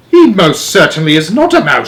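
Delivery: father shouting his denial; shouted. Acted. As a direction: shout him down. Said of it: "shouted Mister Jenkins".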